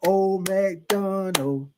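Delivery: put-on Old MacDonald stretched very slowly, syllable by syllable; kindergarten-style slow sounding out of Old MacDonald; sounding out words. Shown as a demonstration. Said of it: "you had to sign out the words".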